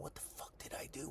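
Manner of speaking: whispered panicked question; whispered worry. Retold or imitated. Student walking down the hall to the office, worried whisper. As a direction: whisper it to yourself, scared.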